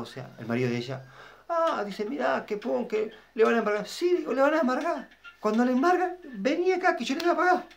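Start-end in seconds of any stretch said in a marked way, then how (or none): 1.5-3.9 much higher, urgent retelling; alarmed brother-in-law. Retold or imitated. Brother-in-law rushing in with the news, alarmed high voice. 3.9-7.7 high, insistent; excited reply. Retold or imitated. Telling his brother-in-law to come, insistent high voice.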